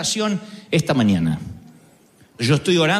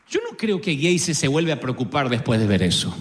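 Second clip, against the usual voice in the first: ordinary delivery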